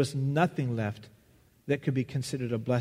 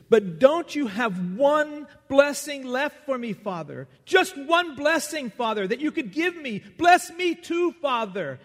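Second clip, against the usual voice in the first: desperate pleading; 11 semitones higher, 5 dB louder than usual. Acted. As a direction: plead for one last blessing, straining higher and louder. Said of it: "And Esau sobbed inconsolably".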